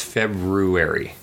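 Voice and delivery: February said slowly with the R after the B; first R pronounced. Shown as a demonstration. Host answering about the first R, careful February. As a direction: sound out Feb-roo-ary in February. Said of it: "supposed to pronounce that first R".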